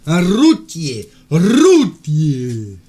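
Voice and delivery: loud, slow drawn-out "routier"; loud drawn-out call. Acted. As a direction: call the word out slowly and loud. Shouting word at caller.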